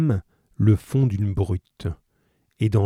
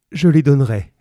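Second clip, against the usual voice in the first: higher, louder quick promise; eager mother; 5 semitones higher, 7 dB louder than usual. A narrator's reading. Mother promises the innkeepers the money, urgent and eager. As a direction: agree urgently.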